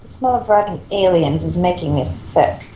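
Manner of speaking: plain reading voice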